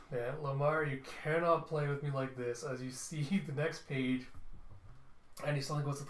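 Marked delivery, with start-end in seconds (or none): none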